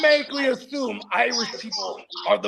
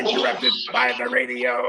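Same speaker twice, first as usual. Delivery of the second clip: Soundwave; 13 semitones lower than usual. Acted. Showing off a Transformers voice to friends, robotic. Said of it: "That was fucking Soundwave from fucking Transformers".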